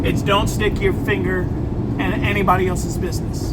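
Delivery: ordinary talk, nothing marked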